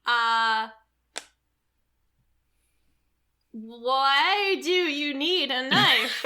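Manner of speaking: slow rock voice; talking rock. Acted. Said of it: "Is my rock voice".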